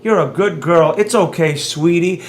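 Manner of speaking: soft, low, soothing sweet-talk; mock-tender, hushed reassurance; mock coddling. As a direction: coo gently as if comforting a small child. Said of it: "I don't talk like that".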